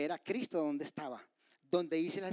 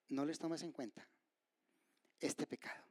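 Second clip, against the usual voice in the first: hushed, low, fading to breath; soft forgiving plea; 3 semitones lower, 9 dB quieter than usual. Acted. Preacher voices Stephen asking mercy for his killers, hushed. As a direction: drop to a dying near-whisper.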